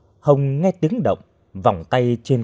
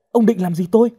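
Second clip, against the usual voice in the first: high, louder frightened question; alarmed; 10 semitones higher, 5 dB louder, faster than usual. A narrator's reading. Captive asking her attacker his intent, alarmed. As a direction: shoot up in pitch with alarm.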